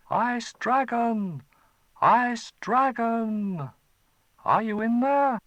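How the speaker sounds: loud, slow, high call. Acted. Chai calling the dragon out of its cave, loud. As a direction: shout into the dark cave.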